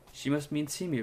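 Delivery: normal talking voice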